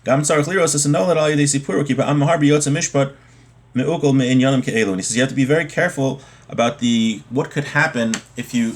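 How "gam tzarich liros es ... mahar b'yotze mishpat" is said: normal talking voice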